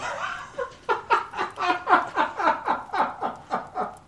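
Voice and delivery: shrill burst of mocking laughter in a high put-on voice; high cackling laugh. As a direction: cackle high like an amused NPC.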